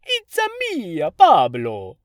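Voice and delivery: cartoonish Italian accent, high then dropping loud on Pablo; mock Jared Leto's over-the-top Italian; imitating Leto's Mario accent. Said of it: "I remember a scene where he went".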